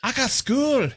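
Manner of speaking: imitating the little Scottish girl's protest, high and loud; a kid complaining "I got school" in a thick Scottish accent. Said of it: "the meme of the little Scottish girl".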